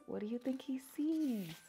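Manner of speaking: usual speaking voice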